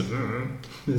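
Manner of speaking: wordless hummed "dunno"; mumbled "I don't know". Shown as a demonstration. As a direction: hum it with lips closed, no words. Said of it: "nem tem como escrever isso".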